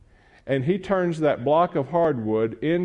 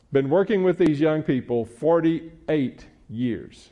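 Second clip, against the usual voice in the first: normal talking voice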